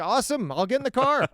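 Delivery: a hint of an excited golden retriever; happy dog. The dog agrees to any trip, in a light, high eager voice. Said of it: "And the dog's like".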